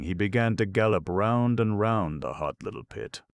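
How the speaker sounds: ordinary delivery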